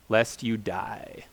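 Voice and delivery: slow, hushed whisper; slow ominous whisper; whispered warning. Acted. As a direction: breathe the threat out slowly. Ominous end of the quoted command.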